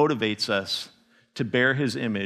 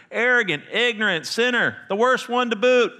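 quoted confession; 9 semitones higher than usual. Acted.

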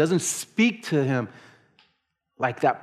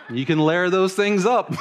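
louder and higher, teasing through laughter; laughing; 6 semitones higher, 6 dB louder than usual. Retold or imitated. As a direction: tease your son while cracking up. Teasing son about tank tops.

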